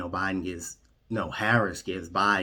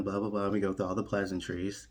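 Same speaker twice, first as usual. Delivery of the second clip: ordinary talk, nothing marked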